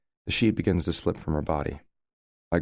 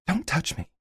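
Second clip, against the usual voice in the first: hissed; 14 semitones higher than usual. A narrator's reading. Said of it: "she hisses".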